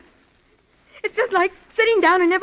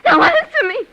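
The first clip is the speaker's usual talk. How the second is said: much higher, louder outcry; shrill demand; 9 semitones higher, 8 dB louder than usual. Acted. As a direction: scream the demand at him. Demands an answer twice.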